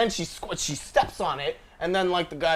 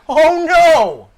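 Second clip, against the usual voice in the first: mock dismay; 12 semitones higher, 12 dB louder, slower than usual. Mock lament over news item.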